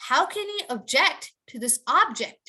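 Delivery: "object" stressed on the end first, "object" stressed on the front second; slow, clear teacher model; object verb vs noun. Shown as a demonstration. Teacher showing students the stress shift, slow and clear. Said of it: "The next one would be".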